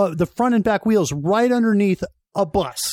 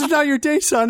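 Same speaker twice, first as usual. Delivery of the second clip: laughing retelling; 6 semitones higher than usual. Retold or imitated. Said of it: "He says".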